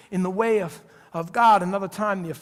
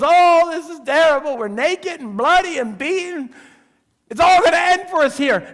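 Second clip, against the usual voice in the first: high, loud, hammed-up lament; the beaten prisoners wailing to each other in the cell; 9 semitones higher, 9 dB louder than usual. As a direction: moan it like despairing inmates.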